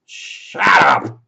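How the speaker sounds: loud, high shout; angry outburst. Real, not acted. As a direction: cut the singing off with a yell. Cuts off his mock hippie singing.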